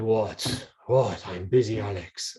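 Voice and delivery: put-on low German-accented grumble; deep German accent. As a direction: play the busy German coach, voice dropped low. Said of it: "there's a deep German accent".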